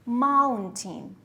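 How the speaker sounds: "Mountain" with MOUN drawn out and hit firmly, falling away; stress on first syllable. Shown as a demonstration. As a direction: lean hard on the MOUN in "Mountain". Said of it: "The stress is on the first syllable".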